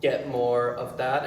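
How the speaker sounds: ordinary talk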